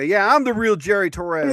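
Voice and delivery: loud gloating villain boast; mustache twirling villain. Pretend. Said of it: "Where's my villain laugh?".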